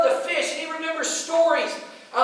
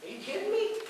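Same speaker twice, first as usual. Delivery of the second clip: much higher and softer, disbelieving; incredulous; 7 semitones higher, 10 dB quieter, faster than usual. Real, not acted. Preacher asking the family why they return, incredulous. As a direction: ask it like you can't believe they'd go back.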